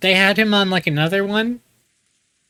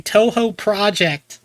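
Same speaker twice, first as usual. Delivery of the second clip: mock game-title announcement, slightly raised; 2 semitones higher, slower than usual. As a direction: imitate a slow, stretched title call.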